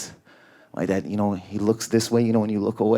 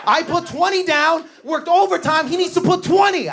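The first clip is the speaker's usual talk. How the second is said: cracked-out friend; 4 dB louder than usual. Retold or imitated. High-on-ecstasy friend's quote.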